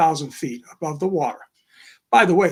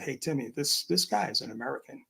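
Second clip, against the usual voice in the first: soft, hushed aside; retold whisper; 9 dB quieter than usual. Telling his partner Timmy quietly, hushed. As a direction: lean in and keep it down. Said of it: "So I whispered to my partner".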